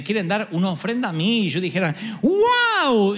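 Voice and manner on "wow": high, loud mock surprise; mock wow. The pastor receiving an offering, mock amazed. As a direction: exclaim it in amazement.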